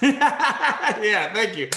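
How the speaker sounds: laughing thanks, high and loud. Real, not acted. Host thanking the student after her prose, laughing. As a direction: laugh your way through the thanks.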